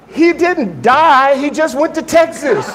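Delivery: high, incredulous mother, played for laughs; mock exasperation with amusement in the voice; exasperated mother. Mother telling crying son that dad only moved, mock exasperation. Mother retold dismissing son's tears.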